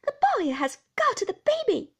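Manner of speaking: high, loud shriek of horror; panicked outcry; horrified cry. A narrator's reading. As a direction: raise the alarm, high and loud. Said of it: "cried Miss Murdstone".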